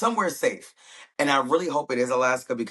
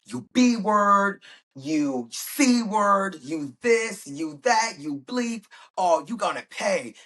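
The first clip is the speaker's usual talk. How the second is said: angry cursing; 9 semitones higher, much slower than usual. Retold or imitated. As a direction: spit the insults out at her. Passengers curse at Serena.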